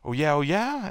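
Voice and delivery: high-pitched mock challenge; rising, provoked comeback; Michael, challenged. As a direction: imitate a star player answering a taunt. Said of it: "Michael says like that".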